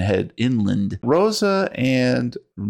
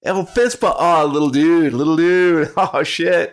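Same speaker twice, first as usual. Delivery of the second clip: stoner bus driver voice; 7 semitones higher, 4 dB louder than usual. Acted.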